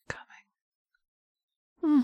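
a high, airy gasp of a word; high breathless whisper. Acted. Lover at the peak, high breathless whisper. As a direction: let it escape on a breath.

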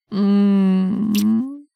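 loud pleased moan. Acted. Lover savoring the moment, satisfied moan. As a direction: hum out your satisfaction.

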